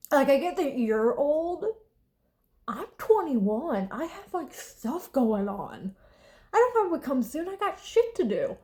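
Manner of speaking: slow, rising, indignant protest; exasperated protest. Real, not acted. Daughter protesting to her dad about the rapture, exasperated and rising. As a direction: plead your case to dad, annoyed.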